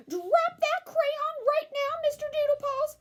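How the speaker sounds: a high stern mother voice giving a firm order; commanding mama. A narrator's reading. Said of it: "commanded Mama Junebug Jones".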